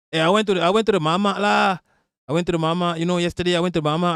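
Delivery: casual Malaysian English with lah. Shown as a demonstration. Friend replying about going to the mama, Malaysian English accent. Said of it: "this how Malaysians speak English".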